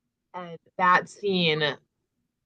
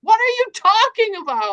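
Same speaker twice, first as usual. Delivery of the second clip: much higher and louder, in disbelief; incredulous Nesta; 8 dB louder than usual. Retold or imitated. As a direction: Nesta can't believe her ears, shrill and loud. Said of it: "And Nesta's like".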